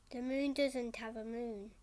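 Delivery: ordinary talk, nothing marked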